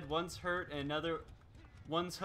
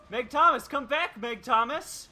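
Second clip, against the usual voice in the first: calling out; 8 semitones higher, 12 dB louder, slower than usual. Acted. Killer calling after fleeing survivor.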